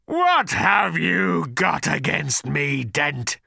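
slow, growling creature snarl; feral snarling. A narrator's reading. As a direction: snarl it like a cornered beast. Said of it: "snarled the creature".

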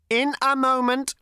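high robot voice, mildly patient. A narrator's reading. Robot repeats itself to Zaphod, lightly patient. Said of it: "repeated the robot patiently".

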